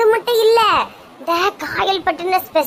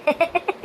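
soft delighted giggle; quiet little laugh to oneself; 6 dB quieter than usual. Acted. Woman savouring his love for her, soft giggle.

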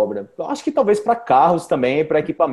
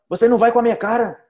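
high, quick mock-offended catchphrase; Kiko; 5 semitones higher, faster than usual. Teacher repeats the sitcom catchphrase in Portuguese, mock indignant. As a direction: lightly imitate an indignant sitcom character, pitched up. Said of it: "que ele fica com raiva".

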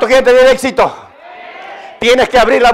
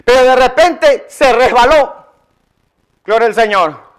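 usual speaking voice

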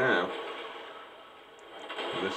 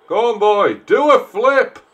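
Futurama quote; 11 semitones higher, 10 dB louder than usual. Retold or imitated. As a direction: ham up the cartoon line. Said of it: "Dad, was that from Futurama?".

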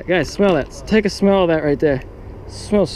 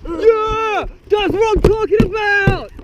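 hyped; slower than usual. Real, not acted. Angler cheering his catch, high excited holler. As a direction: whoop it up over the fish.

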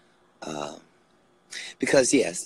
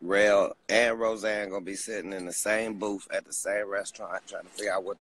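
usual speaking voice

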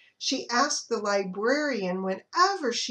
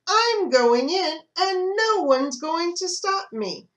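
higher, louder, slow defiant hen; determined hen; 8 semitones higher, 6 dB louder than usual. Acted. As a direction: declare it like a bossy hen. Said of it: "she announced".

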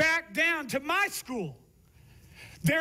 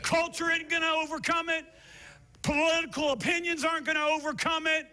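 higher, ringing shouted preaching; full-force preaching; 4 semitones higher than usual. Acted. Pastor preaching the climax to the church. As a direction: drive it home to the congregation.

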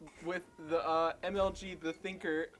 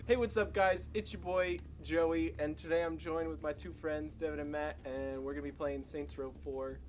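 YouTuber intro. Shown as a demonstration. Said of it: "This is how we start the video".

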